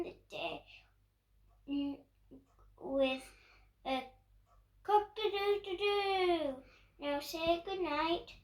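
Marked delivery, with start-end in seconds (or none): none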